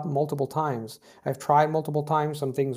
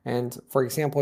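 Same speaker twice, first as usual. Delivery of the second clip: ordinary talk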